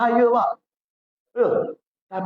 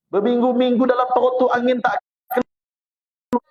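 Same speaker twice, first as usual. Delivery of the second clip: normal talking voice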